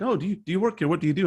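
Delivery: high, curious asking voice; lightly voiced curious coworker asking; curious colleagues. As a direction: pitch it up like a nosy coworker. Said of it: "And people were like".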